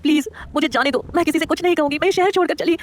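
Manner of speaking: pleading. Acted. Girl pleads with Shivay in the car, high and frightened. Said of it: "अपने हाथ जोड़कर कांपते होठों से बोली".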